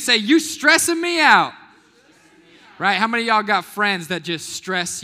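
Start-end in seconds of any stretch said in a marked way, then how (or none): 0.2-1.5 louder, higher, slow and emphatic; really mean it this time; exasperated, slow and loud; like you mean it. Shown as a demonstration. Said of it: "say it like you mean it".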